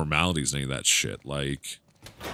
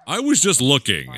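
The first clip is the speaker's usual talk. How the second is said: raised, louder mock-offended retort; higher, put-on indignation; indignant protest; 8 semitones higher, 6 dB louder than usual. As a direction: protest loudly as if wrongly scolded. Protesting at pushy game character.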